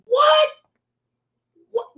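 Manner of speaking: high, loud startled yelp; shocked what. Real, not acted. Reactor hearing a wild line, yelping in shock.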